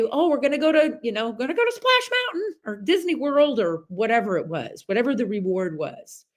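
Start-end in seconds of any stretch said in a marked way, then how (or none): 0.0-3.6 lightly voiced grandma, higher and sing-song; a hint of a sweet promising grandmother; imitating grandma. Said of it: "she would say".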